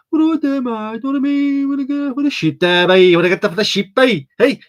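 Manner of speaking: high-pitched mock Birmingham voice; Brummie accent. Streamer mocking the Brummers, high caricature Birmingham accent. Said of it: "Don't diss the Brummers".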